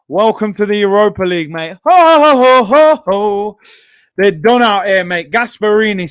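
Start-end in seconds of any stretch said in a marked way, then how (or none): 1.8-3.6 loud, high mocking laugh fading out; gloating ho ho. Real, not acted. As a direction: cackle over the rival's misery. Gloating after the goal.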